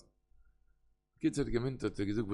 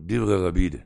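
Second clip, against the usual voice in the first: normal talking voice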